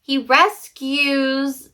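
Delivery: "rescues" drawn out very slowly, syllable by syllable; slow careful rescues. Shown as a demonstration. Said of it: "why can't I say rescues?".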